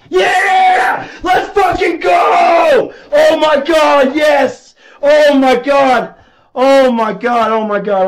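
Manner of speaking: high, loud victory screams, gradually easing; real jackpot celebration; ecstatic shouting. Real, not acted. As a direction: yell in drawn-out elation. Celebrating a big win.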